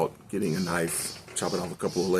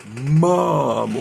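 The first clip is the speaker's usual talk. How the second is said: surprised reveal; 8 semitones higher, 10 dB louder than usual. Real, not acted. Reveal of mother alive.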